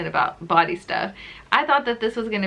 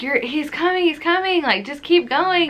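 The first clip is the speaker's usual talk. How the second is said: nurses cheering; 6 semitones higher than usual. Retold or imitated. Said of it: "They're all like".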